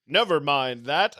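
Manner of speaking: hammed-up hero dodging the question; mock Superman brush-off. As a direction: wave the question away with a loud dismissive line. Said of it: "they would say".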